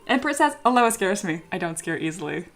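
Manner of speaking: ordinary talk, nothing marked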